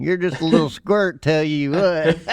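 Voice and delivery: hammed-up Midwest accent, teasing. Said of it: "my Midwest accent came out on that one".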